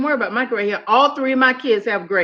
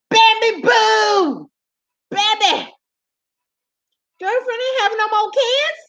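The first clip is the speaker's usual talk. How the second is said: sassy exclaim; 8 semitones higher than usual. Real, not acted.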